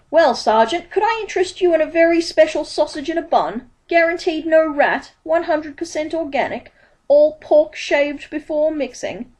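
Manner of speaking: high, eager street-hawker voice; bright-eyed salesman, pitched high; Dibbler the pieman. A narrator's reading. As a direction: pitch it up like a pushy pie seller.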